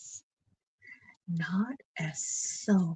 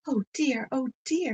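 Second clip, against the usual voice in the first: a mild pained yelp, higher; crying in pain; 6 semitones higher, 4 dB louder than usual. Acted. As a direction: yelp like a burnt mouth. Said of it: "crying and shaking his jaw".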